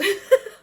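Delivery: loud high laugh; burst of laughter. Real, not acted. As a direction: laugh at yourself. Laughing at herself.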